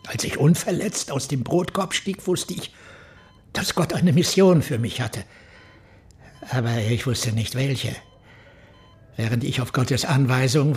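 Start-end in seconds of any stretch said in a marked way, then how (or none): 0.1-5.2 higher and rising, then louder on the mission; exalted. Acted. Reverend tells the detective of his divine mission, exalted. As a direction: swept up in holy certainty.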